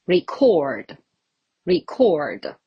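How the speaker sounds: "record" as the verb, ri-KORD; stress on second syllable. Shown as a demonstration. Teacher contrasting verb with noun, "record" stressed on the second syllable. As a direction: punch the end of "record", short first syllable. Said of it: "when it comes to the verb".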